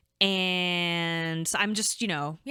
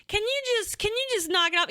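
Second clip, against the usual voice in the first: high, scolding squeal; imitating mom telling dad off; exasperated mom; faster than usual. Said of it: "and she's like".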